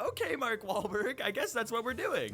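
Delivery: high-pitched, mock-agreeable kid; young self imitation. Twelve-year-old accepts Wahlberg's plan, mock agreeable. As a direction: go along in a put-on kid voice.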